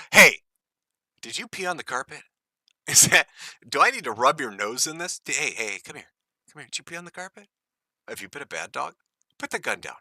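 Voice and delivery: hushed, scolding dog owner voice; mock owner softly scolding a bad dog. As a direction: scold her quietly like a puppy on the carpet. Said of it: "sounds like he's trying to train a dog".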